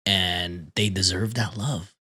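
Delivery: ordinary delivery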